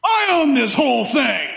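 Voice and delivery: a hint of a haughty rich man, shouting the last line; lightly voiced proud tycoon; smug rich man. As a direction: play the arrogant owner of the world. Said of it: "People are like".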